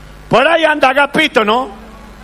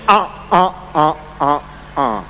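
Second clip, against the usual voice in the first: low mock moaning ah sounds; mock groaning ah; 7 semitones lower, 3 dB quieter, slower than usual. Heckler from the back moaning at the comedian. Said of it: "¿Quién me ha dicho".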